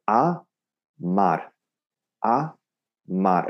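"a mar" split by pauses, neither syllable stressed; equal syllable weight. Shown as a demonstration. Teacher shows students the technique, "a... mar" evenly weighted. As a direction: give "a" and "mar" the same weight, one at a time. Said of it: "say each syllable with almost equal weight".

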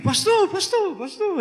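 high, eager call of "Pastor, pastor"; friendly calling out. Retold or imitated. Street people greeting their pastor in a bar, high eager calls.

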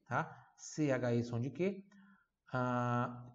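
ordinary talk, nothing marked

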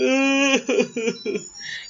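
a burst of high laughter. Real, not acted. Laughing at own story.

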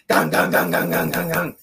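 low loud call through the door; low, loud chant of gang. Retold or imitated. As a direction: bang on the door calling gang. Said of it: "I'm like".

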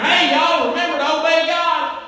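high, loud careless call; mock Noah hollering a warning mid-task; shouting over his shoulder. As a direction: yell it back while busy working. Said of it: "was shouting over his shoulder".

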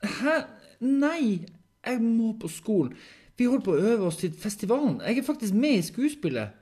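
high, loud, agitated objection; alarmed protest. Acted. Henrik refusing the hospital for school, loud alarmed protest. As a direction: protest urgently at a high pitch.

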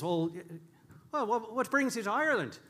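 lightly put-on Irish barman; bad Irish accent. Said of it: "are you ready for a bad Irish accent too".

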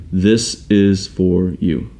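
slow, deliberate, spaced-out words; measured, weighty message with pauses; solemn calling. Retold or imitated. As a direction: speak slowly, letting each phrase land. Retelling God speaking to him.

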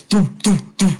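heavy, evenly spaced "doo" thuds; stomping footsteps. Retold or imitated. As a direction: imitate someone stomping hard down stairs. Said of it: "like, really stepping hard in the steps".

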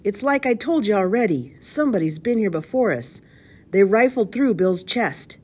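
loud, high pirate's complaint; shouted report. A narrator's reading. Gargoyle-faced man reports from the upstairs window, shouting. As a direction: holler the bad news down to the leader. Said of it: "he shouted".

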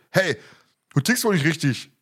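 mildly indignant storybook confrontation; understated read-aloud anger; narrated snarl. A narrator's reading. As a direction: challenge someone with a touch of temper. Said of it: "fauchte der junge Mann".

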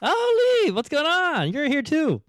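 ordinary delivery

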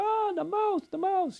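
very high squeal of delight; excited toddler. Retold or imitated. Granddaughter greeting the found mouse with an excited squeal. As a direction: squeal like a thrilled little girl. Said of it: "She said / she's all excited".

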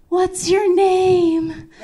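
high, slow calling out to him; drawn-out flirty call. Retold or imitated. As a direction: stretch it out after him as he runs. Calling after the running man.

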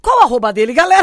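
pergunta bem alta e aguda, zoando; provocação alta. Real, not acted. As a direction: chama a plateia pra expor o cara. Brincando de expor o diretor. (zoeira em voz alta)

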